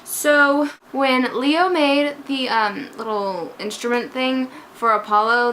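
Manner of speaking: ordinary talk, nothing marked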